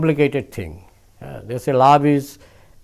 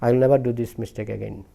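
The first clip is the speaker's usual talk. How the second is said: asking forgiveness; 4 semitones lower, 6 dB quieter than usual. A narrator's reading. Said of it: "gopi asked forgiveness".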